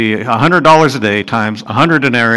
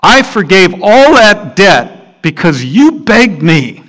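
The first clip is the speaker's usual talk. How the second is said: slow, then louder, rebuking; angry master; 5 semitones higher, 5 dB louder, slower than usual. Acted. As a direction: scold the servant from on high. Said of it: "said to him".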